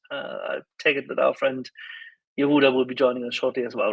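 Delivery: usual speaking voice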